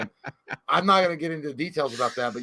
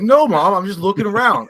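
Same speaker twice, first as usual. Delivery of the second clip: loud, high protest; annoyed son; 6 semitones higher, 9 dB louder than usual. Retold or imitated. Son protests to hovering mom, loud and high. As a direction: push back at mom, loud. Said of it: "I'm like".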